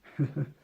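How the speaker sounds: quick high burst of a laugh; a brief laugh at his own joke; short laugh. Real, not acted.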